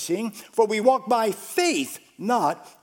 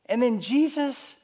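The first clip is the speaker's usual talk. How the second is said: ordinary delivery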